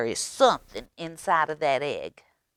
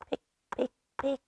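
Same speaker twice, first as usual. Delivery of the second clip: higher, light pecking sound effect; tapping pick pick; 10 semitones higher, much faster than usual. A narrator's reading. Reading to a child, voicing the egg's tapping. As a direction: tap out the pecking rhythm.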